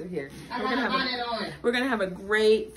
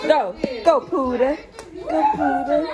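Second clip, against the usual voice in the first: loud rising cheer; calling out encouragement; 4 semitones higher, 4 dB louder than usual. Real, not acted. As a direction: cheer the dog on, loud and bright. Cheering on a dog.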